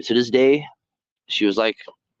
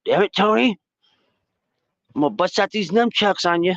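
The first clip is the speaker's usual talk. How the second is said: high put-on voice of a scolding cop; imitating the sergeant threatening him, raised voice; 10 semitones higher than usual. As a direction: play the sergeant warning him off, pitched up. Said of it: "She's like".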